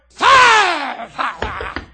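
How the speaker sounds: high, loud yell falling away; explosive shout that tails off; possessed giant's shout. Retold or imitated. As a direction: scream it like a wild-eyed giant. Possessed Goliath yelling, acted.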